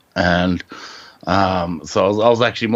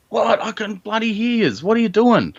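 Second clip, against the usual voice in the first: higher, mock-exasperated protest; frustrated; 12 semitones higher than usual. Retelling to the host, higher, mock-frustrated shout-back. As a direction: play up the annoyance of not being heard. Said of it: "I started getting frustrated".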